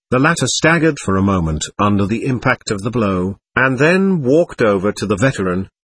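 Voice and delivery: usual speaking voice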